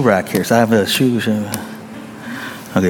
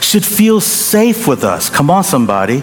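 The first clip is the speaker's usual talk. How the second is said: higher, slow, rising preacher's call; preaching; 6 semitones higher than usual. Acted. Preacher rousing the congregation, higher and slow.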